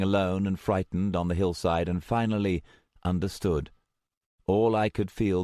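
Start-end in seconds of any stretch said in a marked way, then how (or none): none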